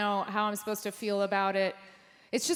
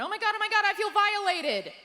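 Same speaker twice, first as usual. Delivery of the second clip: retold startled scream; 11 semitones higher than usual. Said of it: "I just screamed in the moment".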